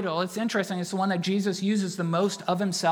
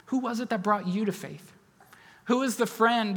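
ordinary delivery

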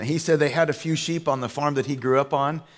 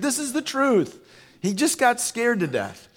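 high-pitched mock protest; exasperated insistence; 11 semitones higher than usual. Son telling his old dad the truth, exasperated insistence.

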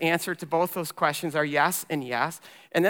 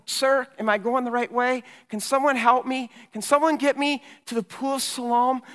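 higher-pitched, searching appeal for help; pleading blind man; 9 semitones higher, 3 dB louder than usual. Acted. Blind man asks strangers the way to the pool, pleading. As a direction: call out to passers-by for directions.

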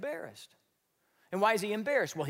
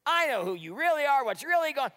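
a taunting Eliab; mocking older brother; 7 semitones higher than usual. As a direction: sneer down at the little brother. Eliab mocking David, preacher voicing.

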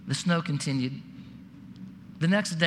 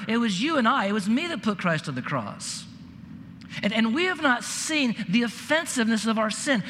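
raised, higher pleading sermon voice; fervent preacher urging the congregation to be broken; preaching at full force; 5 semitones higher than usual. Acted. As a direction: drive the plea home from the pulpit. Preaches on Christ's cross.

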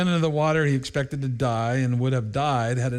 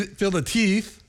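ordinary delivery

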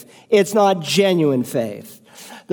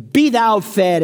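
higher mock-holy pronouncement; mock pious; 4 semitones higher than usual. Preacher mocking a pious man refusing food, solemn and smug. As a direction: intone it like a smug blessing. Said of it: "and you say".

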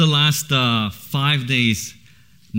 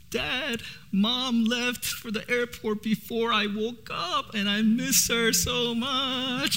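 put-on higher, slow, soft little-girl voice; imitating crying daughter; 12 semitones higher, 6 dB quieter, slower than usual. Dad retelling his daughter missing her mother, soft child voice. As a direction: mimic a sad child missing her mom. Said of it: "and saying".

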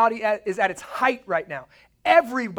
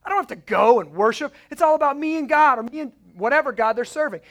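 higher, louder whiny demanding voice; mock self-centered American; 6 semitones higher, 7 dB louder than usual. Preacher mocking American churchgoers, higher and louder. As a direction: lampoon the me-first believer, higher and louder. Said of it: "This is what we want as American".

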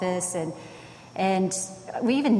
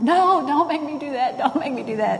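put-on high, louder whining child begging; whiny kid pleading; 8 semitones higher, 4 dB louder than usual. Son begging his dad not to send him to apologize, high whiny plea.

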